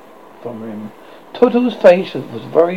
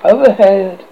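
ordinary delivery, nothing marked

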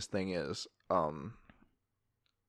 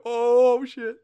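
startled; 13 semitones higher, 9 dB louder than usual. Retold or imitated. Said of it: "I'm like".

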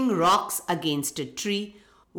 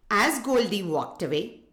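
plain reading voice

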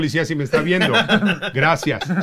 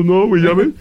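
mock crying; 5 semitones higher, 6 dB louder than usual. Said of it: "ya llorando".

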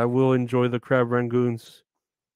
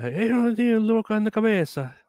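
higher-pitched mock Cuban accent, scolding; Ricky scolding Lucy; 9 semitones higher than usual. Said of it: "he would go".

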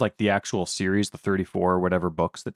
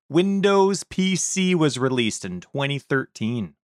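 ordinary talk

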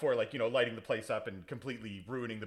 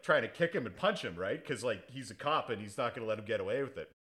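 ordinary delivery

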